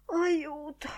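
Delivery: high, faint sighed cry for help; mock-feeble gasp; feigned breathlessness. A narrator's reading. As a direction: sigh it out, pretending to be winded. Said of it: "fingendo d'essere rimasto senza fiato".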